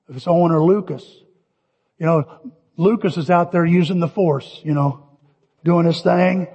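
ordinary delivery

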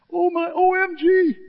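very high squealed OMG; hammed-up shocked squeal, very high; mock OMG. Mocking the disciple's outburst.

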